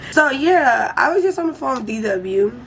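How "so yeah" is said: ordinary delivery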